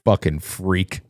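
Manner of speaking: slow, deliberate insult, every word landed; mock vicious put-down, unhurried; proper curse demo. Shown as a demonstration. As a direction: lay the curse down slowly and with weight. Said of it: "That's how you use it".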